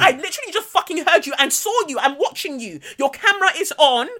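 exasperated retold confrontation; indignant retort. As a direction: hammer home that you witnessed it all. Indignant retort to being placated.

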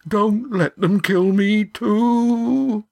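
high, slow whimpering plea; cowering plea. A narrator's reading. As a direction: whimper it, terrified. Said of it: "whimpered Yan".

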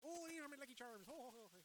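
high, hammed-up Irish leprechaun; Irish accent. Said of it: "not gonna get us a lot of Irish listeners".

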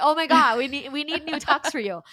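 frantic oh my God; high, loud rushed panic; excited panic. Retold or imitated. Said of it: "We were like".

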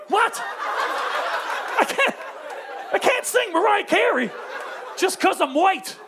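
high-pitched stunned objection; incredulous protest. Acted. Guest told to sing [name], incredulous protest. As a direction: balk in disbelief, voice jumping up.

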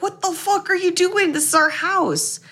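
high shocked outburst; angry yelling. Retold or imitated. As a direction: yell at the intruder. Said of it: "they're like".

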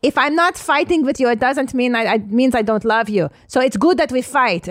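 a hint of a pushy mother lecturing; imitating mom. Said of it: "my mom was such a good fighter".

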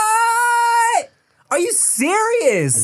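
high, drawn-out shock at the news; slow squeal of stunned surprise; shocked disbelief. Retold or imitated. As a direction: gasp it out, can't believe it. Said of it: "I was like".

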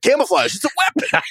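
high-pitched mock protest; mock outraged outburst. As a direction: exclaim it like an absurd battle cry in an argument. Acting out the heated argument.

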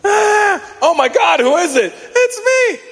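high, shouted mock panic. Kid falling down the stairs, high mock panic shout.

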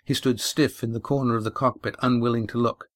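plain reading voice

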